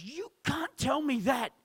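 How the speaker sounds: high, drawn-out cry of protest; slow, high retold yell. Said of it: "Mark yells at him".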